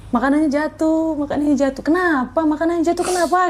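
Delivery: high-pitched tearful lament, retold not sobbed; retold crying. Telling the host how she cried over the crushed order, high tearful plea. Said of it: "Saya langsung nangis".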